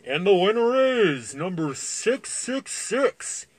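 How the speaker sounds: slow, booming announcer. Acted. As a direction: draw it out like a host. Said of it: "The manager shouted".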